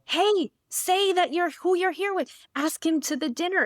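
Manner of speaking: high, urgent self-prompting; imitated frantic inner voice; mock inner scream. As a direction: coach yourself in a comic panic. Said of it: "I'm screaming at myself".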